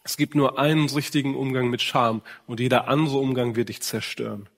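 usual speaking voice

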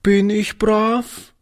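put-on high, slow, timid child's question; small frightened girl asking; anxious little girl. A narrator's reading. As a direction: ask it fearfully, very small. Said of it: "fragte sie ängstlich".

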